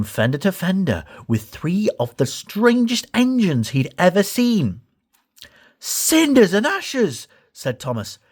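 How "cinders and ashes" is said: high, slow gasp of wonder; amazed exclamation. Acted. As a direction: marvel at it slowly. Thomas amazed by strange engines.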